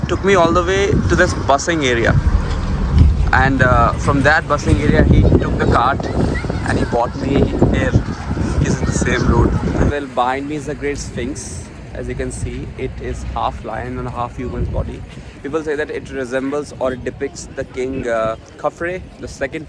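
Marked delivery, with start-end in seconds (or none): none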